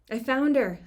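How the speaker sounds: loud call, pitch up; yelling into the radio. A narrator's reading. Rescuer yelling to the search team over the radio, loud. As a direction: shout it into the radio. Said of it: "I yell into my radio".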